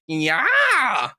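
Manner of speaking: high, drawn-out mimicked shout; exaggerated high meme yell; vine kid impression. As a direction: imitate the kid's silly yelled catchphrase. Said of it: "turns to the camera and just goes".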